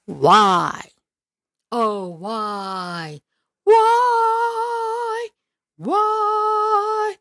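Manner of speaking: drawn-out mock wails of why. Vlogger mocking the film's ratings with wailing whys.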